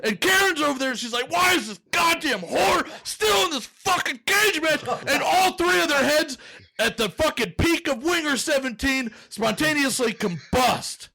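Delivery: frantic high-pitched comic rant, fast and furious; intense, impassioned. Acted. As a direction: build to a very high, strained peak of mock rage. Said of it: "The intensity".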